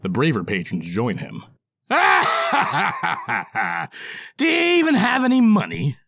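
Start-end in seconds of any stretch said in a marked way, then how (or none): 1.9-4.3 mocking laughter. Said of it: "burst into mocking laughter". 4.4-6.0 high loud taunting dwarf; mocking dwarf. Dwarf character voice.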